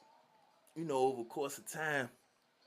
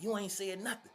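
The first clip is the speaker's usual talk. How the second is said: high, mocking brush-off; dismissive scoff; 9 semitones higher than usual. Croc shrugs off the threat, high and mocking. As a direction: wave him off.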